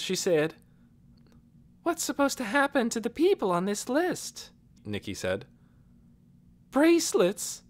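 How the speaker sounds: lightly voiced young girl, confiding; a touch of a guilty girl. Girl character voiced throughout.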